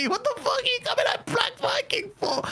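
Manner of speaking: high, cracking laugh-talk; can't believe who he's going after; laughing in disbelief at the wrong target. Real, not acted.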